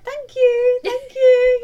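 high, loud squeaky thank you; a shrieking sarcastic thank you to people pushing through; mock sarcastic thanks. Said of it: "I was just like".

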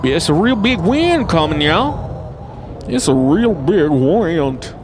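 drawled country character voice; rustic storm watcher. Acted. Country character warning of coming wind, drawn-out drawl. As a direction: stretch the words like a hammed-up yokel. Plays rustic horse scene.

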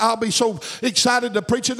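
normal talking voice

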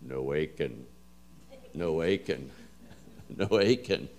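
"Noachian" as no-AY-kin, starting low and rising with each repeat; "Noachian" spaced out with a K sound, no-AY-kin; imitating pronunciation voice. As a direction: mimic an online guide saying "Noachian" as no-AY-kin, breaking into a laugh. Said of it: "So I pressed the third one".

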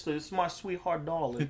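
put-on soft, shy Southern accent; timid Southern sweetheart. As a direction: imitate a gentle Southern man, quietly. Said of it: "he's very timid and shy".

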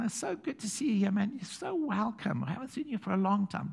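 high-pitched, gushing retold welcome; loving welcome. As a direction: gush over long-missed guests, voice lifted. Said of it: "Just hugged them and loved them".

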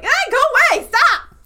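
very high, loud yell; a girl shouting at a centipede while kicking it; panicked shout. Acted. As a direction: scream at the monster to back off. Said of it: "Now I'm just like".

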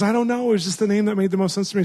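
a casual shrug of an answer, hurried; imitating Caitlyn Jenner. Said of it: "And she goes".

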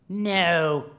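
long, loud creature no; loud refusal. Acted. Preacher as Gollum resisting banishment, loud refusal. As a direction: refuse it forcefully.

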